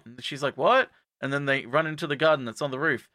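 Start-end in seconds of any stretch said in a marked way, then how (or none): 0.6-0.9 high, rising question; mock surprise. Girl told about the dog, baffled. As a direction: squeak it in disbelief.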